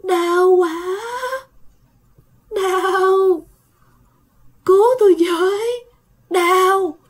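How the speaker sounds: high, drawn-out moans of pain; ghostly moaning. A narrator's reading. Ghost moaning again outside the shop, high and pleading. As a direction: moan slowly, begging to be saved. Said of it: "lại nghe tiếng rên".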